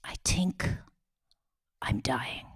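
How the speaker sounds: hushed whisper with long pauses; frail dying father. Retold or imitated. Dying dad tells his children the truth, whispered and halting. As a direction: let the words out softly, one bit at a time.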